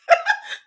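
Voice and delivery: sudden high squeal of a laugh; cracking up at the admission; high burst of laughter. Real, not acted.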